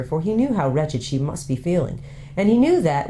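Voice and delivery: ordinary delivery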